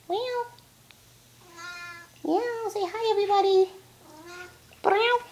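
squeaky playful cat imitation; coaxing meows. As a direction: meow up at the cat to get it talking. Meowing at cat to coax it.

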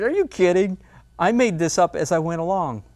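a playful inner scoff; mock incredulous. Painter's inner voice answering admirers, mock disbelief. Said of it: "on the inside, I'm going".

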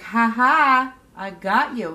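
gloating. Retold or imitated. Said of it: "he's looking back at her like".

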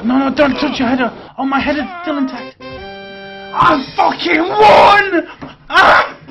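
screaming with joy at winning; triumphant shout. Real, not acted. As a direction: yell out the victory.

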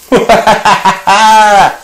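high staccato puppet laugh. Pretend. Glove mitt greets viewers, high puppet laugh.

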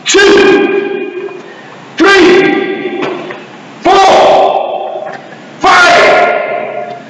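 high-pitched shouted count; loud shout. Shown as a demonstration. Instructor counting out a leg drill, loud shout. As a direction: shout each number out high, with gaps between.

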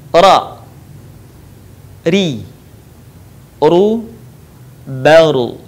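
'ra, ri, ru' with front tongue on the palate, 'baru' ending on a closed r; tongue-tip ra. Shown as a demonstration. Teacher showing letter ra, separate tongue-tip syllables. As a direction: sound out each r syllable singly. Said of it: "dikeluarkan dengan cara menyentuhkan lidah yang terdepan".

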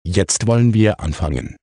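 plain reading voice

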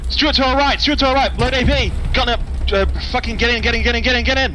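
high, panicked yelling; cover me shout. Real, not acted. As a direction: scream the warning, urgent. Enemy tank spotted, calling gunner.

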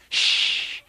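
long insistent shush; drawn-out hissed shush. Acted. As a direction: shush a noisy lover. Lover hushing Tony again.

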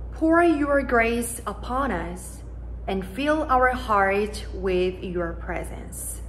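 ordinary delivery

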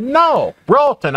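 "Ralton" said high and patient, as a correction; spelling it out for him again; correcting Ralton. Retold or imitated. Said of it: "And I said".

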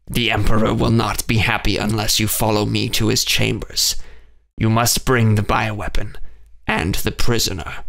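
cold, commanding alien captain. A narrator's reading. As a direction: give the order like an unfeeling alien officer. Valakor captain voiced.

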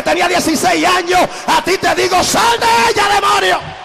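from stern warning to roaring exorcism; rising to a shouted rebuke. Retold or imitated. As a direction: command the demon out with full force. Rebuking the demon in Christ's name.

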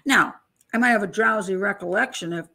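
ordinary talk, nothing marked

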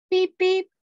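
high, bright sound-effect beep; playful beep. A narrator's reading. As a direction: chirp it like a toy horn.

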